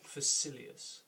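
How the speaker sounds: Facilius whispered soft with rolled, drawn-out syllables; rolled name. Shown as a demonstration. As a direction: say Facilius softly, rolling the sounds. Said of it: "the way I pronounce his name".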